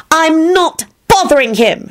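slow, high-pitched denial; high, stretched-out defiance; defiant shriek. A narrator's reading. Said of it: "she shrieked back defiantly".

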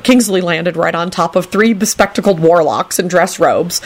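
ordinary delivery, nothing marked

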